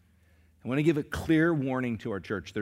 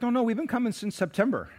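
mildly offended couple; 11 semitones higher than usual. Retold or imitated. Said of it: "this couple's like".

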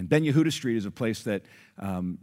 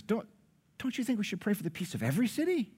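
high, quick broken-English voice; broken English accent; 10 semitones higher, 4 dB quieter, faster than usual. Retold or imitated. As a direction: imitate a shopkeeper's broken English, pitched up. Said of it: "in his broken English accent".

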